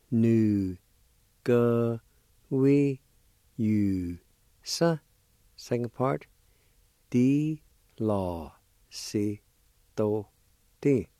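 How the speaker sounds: each syllable said apart with a pause. Shown as a demonstration. Teacher sounding out fundamental, syllable by syllable. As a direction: give every syllable its own beat. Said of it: "pronounced like this".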